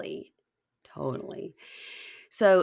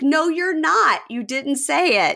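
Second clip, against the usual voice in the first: high, loud insistent denial; sharp denial; 9 semitones higher, 7 dB louder than usual. Retold or imitated. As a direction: cut him off, insisting. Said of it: "And she's like".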